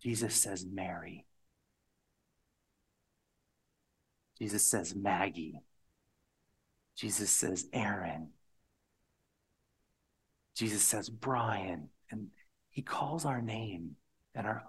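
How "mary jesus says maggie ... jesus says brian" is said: tender calling. Acted. Jesus calling each name.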